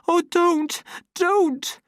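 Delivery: high, loud, shaken begging; trembling plea. A narrator's reading. Lenina to the savage shaking her, trembling plea. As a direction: beg him to stop while being shaken. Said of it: "a voice which trembled from the shaking".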